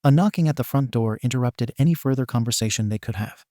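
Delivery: plain reading voice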